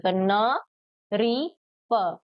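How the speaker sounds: teacher spelling a word for pupils; very slow syllables. Shown as a demonstration. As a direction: stretch न री प out very slowly. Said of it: "री करके ही pronounce करते हैं".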